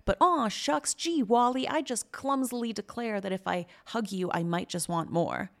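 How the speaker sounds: mock his innocent act, gosh-golly style; sarcastic imitation of a sheepish boss; mocking aw-shucks. Mocking a boss's clumsy confession.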